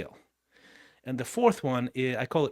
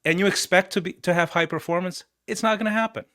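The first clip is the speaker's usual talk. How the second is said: higher, louder disbelief; incredulous; 6 semitones higher, 6 dB louder than usual. Real, not acted. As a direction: scoff at the idea. Incredulous rhetorical question.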